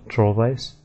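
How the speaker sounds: "Trollweiss" with a V sound; correct way. Shown as a demonstration. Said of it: "actually pronounced Trollweiss".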